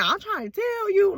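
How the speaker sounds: high-pitched exasperated plea; playful high-voiced insisting; acted insistence. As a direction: push it up high, mock pleading. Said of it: "like, me saying".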